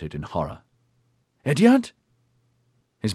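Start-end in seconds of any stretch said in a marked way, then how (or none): 1.4-1.9 higher, louder, anxious; urgent call. Acted. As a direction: call his name in alarm. Anxious call after arrow hit.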